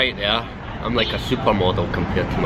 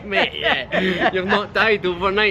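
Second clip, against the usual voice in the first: laughing; 10 semitones higher, slower than usual. Retold or imitated. Retelling mates' teasing.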